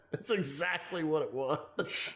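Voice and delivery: talking through real laughter, slowly; drawn out over a laugh. Real, not acted. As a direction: agree while still cracking up. Agreeing while cracking up at joke.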